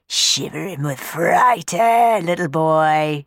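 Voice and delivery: slow, taunting, put-on bully voice; mocking sneer. Sweep taunting the little boy, slow mocking sneer. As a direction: jeer at the frightened boy. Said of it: "he mocked".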